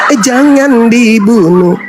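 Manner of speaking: slow, drawn-out mock protest; devil's fake plea. Preacher as devil hiding the intent, insincere slow plea. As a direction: pretend to object, stretching it out. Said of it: "Itu cara siasat iblis itu gitu".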